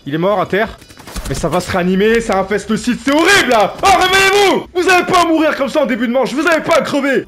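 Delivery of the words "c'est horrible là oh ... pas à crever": yelling, much higher and louder; furious high-pitched yell at randoms; angry shout. Real, not acted. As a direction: scream at the useless teammates. Yelling at dying teammates.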